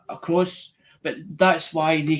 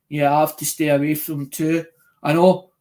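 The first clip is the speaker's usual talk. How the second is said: ordinary talk, nothing marked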